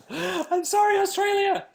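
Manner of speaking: very high mock-sorry squeal; tiny high-pitched playful voice; squeaky apology. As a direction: squeak out an apology after a laugh. Apologising for the bad accent.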